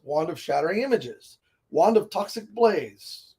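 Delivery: ordinary talk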